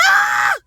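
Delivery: very high loud shriek; very high loud disgusted scream. Retold or imitated. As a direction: scream at the top of your lungs. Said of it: "I screamed".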